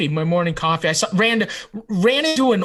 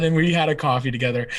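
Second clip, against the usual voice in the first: ordinary delivery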